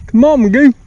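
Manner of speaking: high, loud call to a dog. Real, not acted. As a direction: call him along. Calling the dog again.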